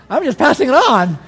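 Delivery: retold defensive yelp; mock defensive protest. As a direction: protest your innocence, playfully. Pleading back to angry lady.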